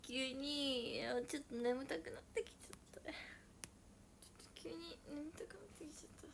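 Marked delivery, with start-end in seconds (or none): 1.3-6.3 soft drowsy whisper; sleepy whisper. Pretend. Pretending to fall asleep for viewers, in a drowsy whisper. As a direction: whisper like you're dozing off.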